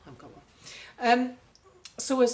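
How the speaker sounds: usual speaking voice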